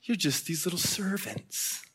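soft, slow belittling; hushed retold taunt. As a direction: imitate a quiet, drawn-out put-down. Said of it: "he's taunting them and just saying".

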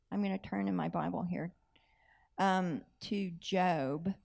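whispered aside to the audience. Real, not acted. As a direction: drop to a whisper, just between us. Aside while turning pages.

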